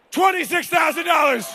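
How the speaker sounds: high shout on the number; shouted bid call. Acted. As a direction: belt out the new bid. Auctioneer calling the bid.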